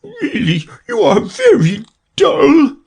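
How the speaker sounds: very high, slow and weepy reproach; tearful, cross Mock Turtle. A narrator's reading. Mock Turtle reproaching Alice, tearful and cross. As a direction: sob the scolding in a high turtle voice.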